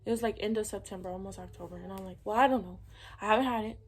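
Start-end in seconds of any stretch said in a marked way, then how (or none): 2.2-3.8 louder, defensive retort; retold exasperation. Daughter answering mom's questioning about her period, retold exasperation. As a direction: push back loudly, imitating a fed-up reply.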